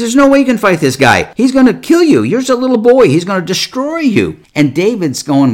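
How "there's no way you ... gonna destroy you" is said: high, raised-voice acted protest; hammed-up, high and insistent disbelief; incredulous warning. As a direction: warn the boy off, pitched way up. Acting King Saul warning young David.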